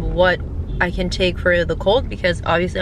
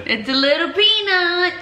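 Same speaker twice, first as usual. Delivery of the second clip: tender; 10 semitones higher than usual. Real, not acted. Echoing a tender remark.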